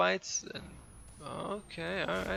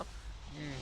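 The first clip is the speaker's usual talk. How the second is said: usual speaking voice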